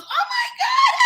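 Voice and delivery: mocking a woman's overacted gasp; mock dramatic shriek. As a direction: ham up her fake distress. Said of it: "and then she goes".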